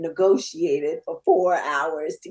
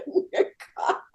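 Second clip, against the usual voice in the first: usual speaking voice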